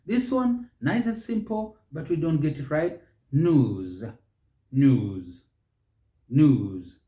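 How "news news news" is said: "news" as NYOOZ; British news. Shown as a demonstration. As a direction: glide smoothly into "news". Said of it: "That's the British".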